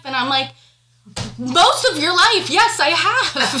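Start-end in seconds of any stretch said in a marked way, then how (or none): 1.4-3.6 high, loud, emphatic retort; heated retelling tipping into a laugh; retold exasperated comeback. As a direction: protest it loudly, stretching the last words.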